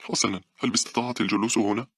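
put-on high, uneasy child voice; worried boy. A narrator's reading. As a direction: ask anxiously as a small boy. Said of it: "سأل بقلق".

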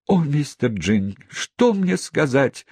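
high, slow despairing plea; despairing cry. A narrator's reading. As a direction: break down again in despair. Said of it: "вторично отдавшись приступу отчаяния". (в отчаянии)

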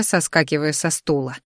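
ordinary delivery, nothing marked

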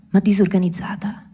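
ordinary talk

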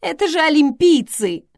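high, loud, tearful wail; hammed-up, upset outcry; sobbing narration voice. A narrator's reading. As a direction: wail it in helpless distress. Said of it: "всхлипнула Селия".

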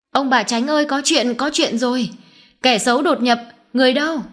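high-pitched urgent calling out; frightened alarm call. A narrator's reading. Servant rouses the household about an intruder, alarmed call. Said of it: "sợ quá hô hoán ầm hết cả lên".

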